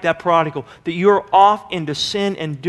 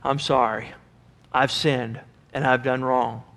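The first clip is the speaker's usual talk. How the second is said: humble apology; 6 semitones lower, 8 dB quieter than usual. Retold or imitated. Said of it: "son comes back and says".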